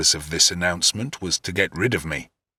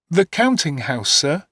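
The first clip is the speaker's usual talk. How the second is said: put-on higher, timid questioning child; lightly voiced small boy, unsure; puzzled young boy; 9 semitones higher than usual. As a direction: echo it back in bewilderment. Young David repeating, puzzled.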